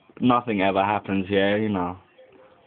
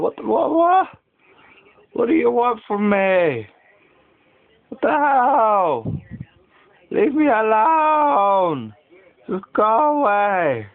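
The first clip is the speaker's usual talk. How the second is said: mock groggy yell; 12 semitones higher, 3 dB louder, slower than usual. Groggy son yelling back.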